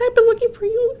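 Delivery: high squeaky duck voice; high quacky voice. A narrator's reading. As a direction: chirp it like a little duck.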